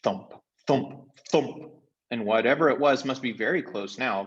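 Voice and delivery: ordinary delivery, nothing marked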